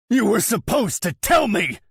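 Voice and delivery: high, loud roaring reproach. A narrator's reading. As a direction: roar it at him. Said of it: "I roar".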